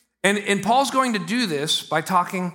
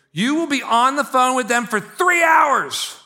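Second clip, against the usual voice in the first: high, slow emphatic shout; comically indignant, raised voice; exaggerated outrage; 6 semitones higher, 3 dB louder, slower than usual. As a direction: blow up over a petty grievance. Mock outrage over a small overcharge.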